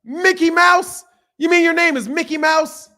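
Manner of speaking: mock disbelief. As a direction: feign disbelief at the name. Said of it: "I'd be like".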